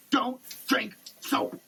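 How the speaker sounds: normal talking voice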